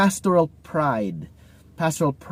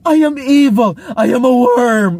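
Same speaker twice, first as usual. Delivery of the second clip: much higher and louder, hammed-up wailing contrition; groveling self-debasement; 11 semitones higher, 7 dB louder than usual. Corrected person debasing himself to the one correcting him, hammed-up high wail. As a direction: overplay the grovel like a wounded martyr. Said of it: "they suddenly debase themselves".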